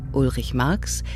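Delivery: ordinary delivery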